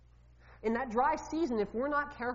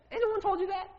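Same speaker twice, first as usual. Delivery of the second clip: repeated question, much higher; desperate plea, pitched up high; pleading fear; 10 semitones higher, 3 dB louder, faster than usual. Acted. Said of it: "Can you hear the fear in his voice?".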